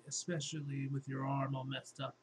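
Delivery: ordinary talk, nothing marked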